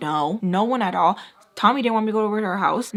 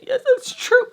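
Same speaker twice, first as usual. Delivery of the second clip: high, louder retort; emphatic insistence; 6 dB louder than usual. Retold or imitated. Arguing back at him about the gas station, insistent. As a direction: insist in a higher, raised voice.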